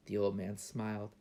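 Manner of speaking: ordinary delivery, nothing marked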